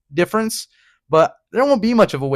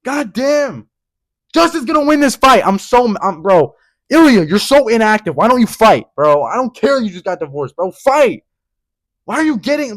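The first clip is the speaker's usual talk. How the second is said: louder, exasperated scolding of Ilya; real fan rage; getting mad; 5 semitones higher, 3 dB louder than usual. Real, not acted. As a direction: chew out an absent fighter. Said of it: "I'm starting to get mad again".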